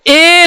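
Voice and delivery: ए stretched long, as if calling someone far off; drawn-out ए. Shown as a demonstration. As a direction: hold the vowel ए before moving on. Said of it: "लंबा खींचेंगे". (ए को लंबा खींचकर)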